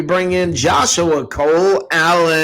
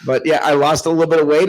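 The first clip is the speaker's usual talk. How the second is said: ordinary talk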